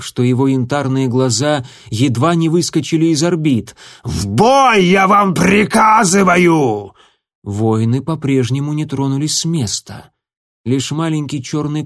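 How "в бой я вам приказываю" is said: high, loud, slow furious shriek. Acted. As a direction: scream the order in rage. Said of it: "Звездоцап завизжал так".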